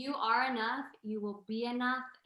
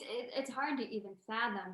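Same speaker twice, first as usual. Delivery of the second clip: normal talking voice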